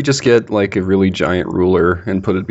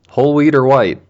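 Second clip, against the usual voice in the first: drive thru speaker; 6 semitones higher, 4 dB louder than usual. Acted. Drive-thru worker asking order.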